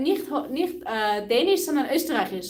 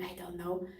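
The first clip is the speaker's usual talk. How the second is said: whispered aside; 5 semitones lower, 11 dB quieter than usual. Real, not acted. Unsure aside while listing foods.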